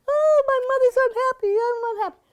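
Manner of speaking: mock a simpering film child; high mock sulky girl; insipid little girl. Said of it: "Little Mary is like".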